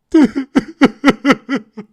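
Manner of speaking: high, loud villain cackle; maniacal laugh. Acted. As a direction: cackle like the Joker. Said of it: "The Joker stream has begun".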